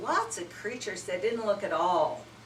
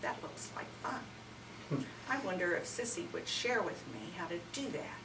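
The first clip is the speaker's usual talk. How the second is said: softly hushed wondering; calmed brother wondering quietly; 8 dB quieter than usual. Acted. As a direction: murmur it to yourself, curious. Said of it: "he said".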